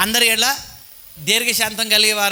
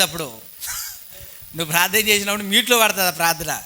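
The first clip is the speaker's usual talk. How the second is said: normal talking voice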